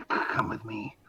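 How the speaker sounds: low, soft and secretive; a hushed invitation to follow; Shock's quiet voice. Acted. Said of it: "Shock said to D M".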